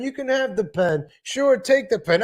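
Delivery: mock sitcom host pressing the gift; hammed-up New York insisting; insisting on the pen. Acting out the pen back-and-forth.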